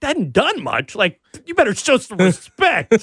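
hammed-up outrage, laughing through it; mock heated outrage. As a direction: play offended while cracking up. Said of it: "I got way too heated".

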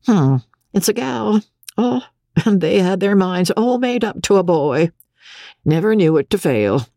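high character voice of a grumbling midwife; testy, tired fat midwife. Acted. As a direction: remark on it like a seen-it-all midwife.

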